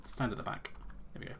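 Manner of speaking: ordinary talk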